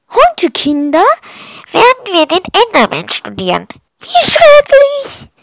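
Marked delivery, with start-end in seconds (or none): 4.0-5.3 high, loud, drawn-out cry of horror; slow high squeal of alarm; horrified outcry. Acted. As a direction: cry out in exaggerated dread. Class reacts to Enderman lesson.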